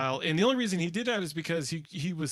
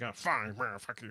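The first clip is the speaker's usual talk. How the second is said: grumbling dismissal; 6 semitones lower, 4 dB quieter than usual. Retold or imitated. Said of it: "He's like".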